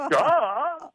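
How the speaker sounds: high, exaggerated dopey retort; mock duh. Boss answers employee about the song, high mocking duh. Retold boss's dumb reply.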